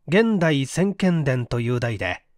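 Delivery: plain reading voice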